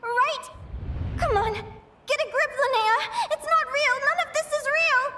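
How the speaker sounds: high, shaky, stammering panic; panicked. Acted. Linnea trying to convince herself it is a dream, panicking. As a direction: talk yourself out of terror.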